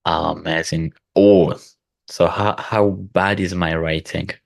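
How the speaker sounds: normal talking voice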